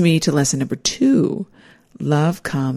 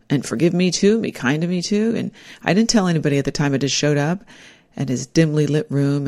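normal talking voice

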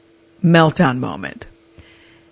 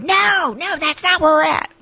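mocking; 12 semitones higher than usual. Mocking the friend.

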